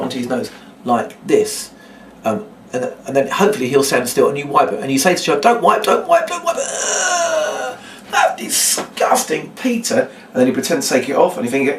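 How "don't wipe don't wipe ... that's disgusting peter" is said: high squealed protest turning into drawn-out disgust; hammed-up squeamish outburst. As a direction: squeal in mock horror, then draw out the revulsion. Acted protest at child wiping nose.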